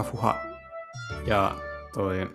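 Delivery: normal talking voice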